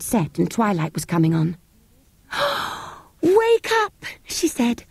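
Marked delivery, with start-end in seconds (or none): 2.3-2.9 sharp breathless gasp of delight; high unvoiced gasp of joy; joyful gasp. A narrator's reading. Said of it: "almost in tears of joy". 3.2-4.0 high, excited wake-up call; much higher, tearful joy; joyful, near tears. A narrator's reading. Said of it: "almost in tears of joy".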